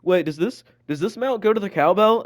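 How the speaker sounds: lightly mocking a clueless classmate; mock dim question. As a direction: play it airheaded and puzzled. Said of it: "he's like".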